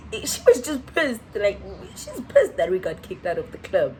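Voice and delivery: ordinary delivery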